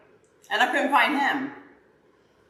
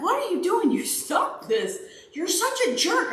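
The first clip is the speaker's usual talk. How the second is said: high-pitched, exasperated scolding; mock fussing; 10 semitones higher than usual. Owner retelling scolding her runaway dog, mock exasperation. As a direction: act out telling off a naughty dog. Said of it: "I fussed at him".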